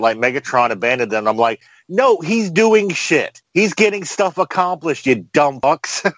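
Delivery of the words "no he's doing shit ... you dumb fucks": slow, high-pitched indignant correction; drawn-out, pitched-up exasperation; exasperated retort. Retold or imitated. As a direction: spell it out slowly for idiots. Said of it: "Windblade and Victorion are bitching".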